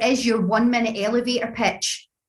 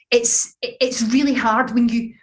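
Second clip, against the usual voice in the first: ordinary talk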